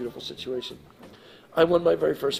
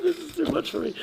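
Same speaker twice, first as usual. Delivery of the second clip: mock overwhelmed wail. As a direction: blurt it out in a comic, high wail. Retelling his tearful bolt offstage.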